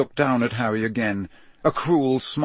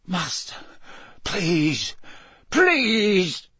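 frightened begging; 8 semitones higher, much slower than usual. Acted. Servant begs his master for mercy, high and desperate. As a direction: beg high, each plea more desperate.

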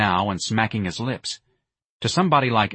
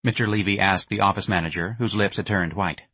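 usual speaking voice

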